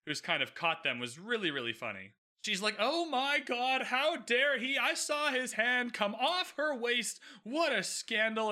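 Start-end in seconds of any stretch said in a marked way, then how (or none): 2.8-8.5 higher, slow, overacted gasp of outrage; hammy scandalised matron. Mama Featherington faking outrage for the onlookers, hammed up. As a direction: ham it up like a bad actress faking shock. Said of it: "is the worst actress".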